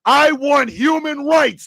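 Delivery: slow, lecturing shout at Tony; schooling shout. Real, not acted. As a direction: drill each word into Tony. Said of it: "let me go ahead and school your silly ass".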